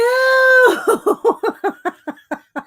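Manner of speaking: high, loud shriek of laughter; loud burst of laughter. Real, not acted. Streamer cracks up at her own joke post, loud laughter.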